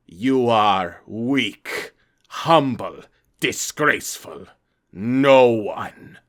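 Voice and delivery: loud, slow monster voice; booming monster voice. Acted. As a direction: drag each word out, loud and menacing. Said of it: "loud, booming voice".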